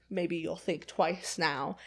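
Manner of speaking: slow, soft quoted threat; quiet retold warning. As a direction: deliver it slowly and quietly, up close. Acted warning, face close up.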